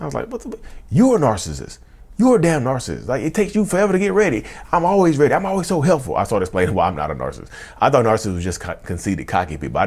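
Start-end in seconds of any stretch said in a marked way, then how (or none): 0.3-6.2 high-pitched indignant retort, reenacted; acted-out quarrel, self-justifying; defensive comeback. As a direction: fire the accusation back, pitch way up. Acting his defensive comeback in quarrel.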